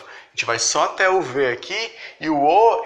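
ordinary talk, nothing marked

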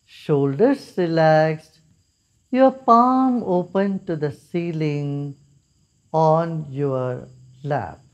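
calm, unhurried meditation guide with long pauses; guided meditation. Real, not acted. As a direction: lead them gently into stillness, phrase by phrase. (शांत, ठहराव भरा ध्यान निर्देश)

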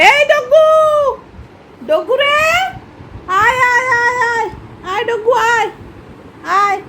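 high sing-song call to a pet; high calling voice to a dog. Real, not acted. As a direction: call the dog over sweetly.

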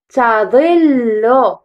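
تظل drawn out very slowly; slow syllable reading. Shown as a demonstration. Teacher sounds out the word slowly for children. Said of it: "رددوا".

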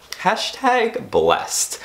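high, very slow, hammed-up "hashtag blessed"; mock blessed. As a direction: draw it out high like a cheesy influencer. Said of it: "What was that?".

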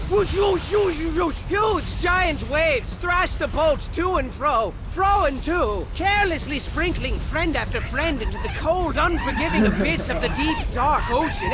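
shouted whoosh effects then loud dramatic narration; booming storm storyteller; loud wave whooshes. Acted. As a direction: whip up the waves. Storm waves narrated loudly.

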